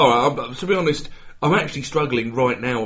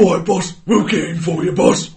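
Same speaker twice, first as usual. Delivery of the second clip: higher, louder dim henchman; goofy henchman; 6 semitones higher, 6 dB louder than usual. Acted. Henchman reassuring the boss, goofy and loud. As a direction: play an eager goofy sidekick. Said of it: "one of the goofy henchmen".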